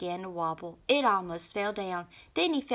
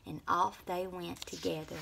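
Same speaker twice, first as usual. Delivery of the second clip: ordinary delivery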